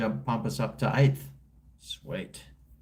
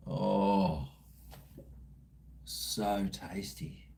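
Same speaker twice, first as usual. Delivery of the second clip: savouring it; 4 semitones lower, 5 dB quieter, slower than usual. Real, not acted. Savouring memory of a burger.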